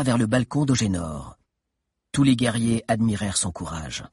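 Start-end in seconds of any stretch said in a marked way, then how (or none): none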